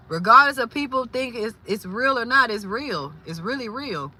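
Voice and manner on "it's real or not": normal talking voice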